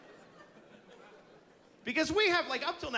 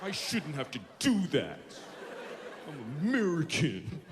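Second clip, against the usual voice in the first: whiny American complaint. Acted.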